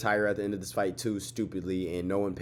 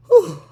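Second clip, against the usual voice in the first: loud whew; 17 dB louder than usual. Real, not acted. Loud exhale after yawning.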